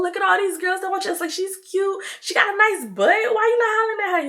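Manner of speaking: high, insistent pitch that keeps climbing; pushy teasing. Retold or imitated. Urging her friend to chase other girls, high-pitched teasing.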